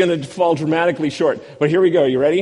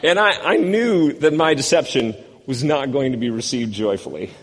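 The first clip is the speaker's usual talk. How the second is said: ordinary talk, nothing marked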